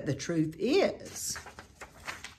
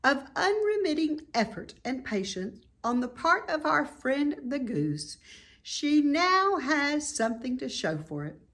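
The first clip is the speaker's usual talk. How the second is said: higher, measured, formal voice; Charlotte's announcement; 8 semitones higher than usual. A narrator's reading. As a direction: deliver it like a gracious speech. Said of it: "made the announcement".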